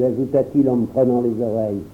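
ordinary delivery

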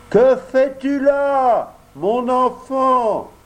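high, loud, slow call from the sky. A narrator's reading. The pigeon calling down to Pinocchio from the sky, loud slow call. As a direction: call down from far above. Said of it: "lui cria d'une grande hauteur".

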